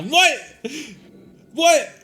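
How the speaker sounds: high, loud disbelieving what; shocked what. Acted. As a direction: yelp it in disbelief. NPC walking away from him.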